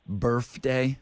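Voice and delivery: "birthday" said as "birfday", f for th; "birthday" with an f sound in place of th. Retold or imitated. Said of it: "Birth with an F?".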